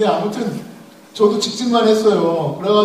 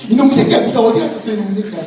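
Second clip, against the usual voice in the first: loud, high angry scolding; father yelling at his found child, angry shout; 4 semitones higher, 6 dB louder than usual. Retold or imitated. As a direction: bark at the lost kid like a furious dad. Parent scolding lost child.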